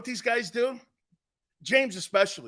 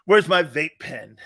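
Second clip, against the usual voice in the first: louder, slow stoner demand; 4 semitones lower, 6 dB louder than usual. Pretend. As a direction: drag the words out loud like a dazed stoner. Mock stoner searching for vape.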